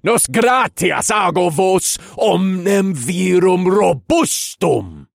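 loud, slow shouted chant; shouting. A narrator's reading. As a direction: shout the spell at full force. Incantation continues, shouted.

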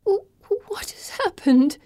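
high, slow whimper breaking into a cry; frightened crying question. Acted. Dazed Pippa asking Felicity what happened, whimpering. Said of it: "Pippa whimpers".

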